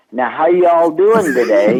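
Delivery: a folksy Southern hello, hammed up with a laugh; Southern accent. Lassie greeting folks in a mother's Southern accent. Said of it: "My mother had a Southern accent".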